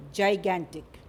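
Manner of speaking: "gigantic" modelled clearly as "jai-GAN-tic"; teacher's careful "gigantic", starting like "giant"; model pronunciation. Shown as a demonstration. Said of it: "You have to pronounce it as".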